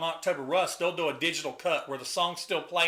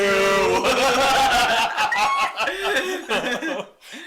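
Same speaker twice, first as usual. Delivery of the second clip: loud laugh; 8 semitones higher, 9 dB louder than usual. Real, not acted. Laughing at the story.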